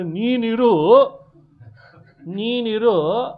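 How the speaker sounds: loud, insistent acted order; emphatic command. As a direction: declaim it forcefully as a king's command. Rama insisting to Jambavan, acted.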